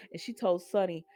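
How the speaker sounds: ordinary delivery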